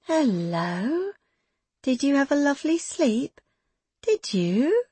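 put-on high, sing-song baby talk with forced sweetness; sugary baby talk. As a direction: coo to the baby though bitter inside. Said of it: "Her voice was sugar".

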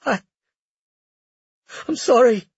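high tearful apology. A narrator's reading. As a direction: choke out the apology. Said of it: "Hot tears streaked down Lucia's cheeks".

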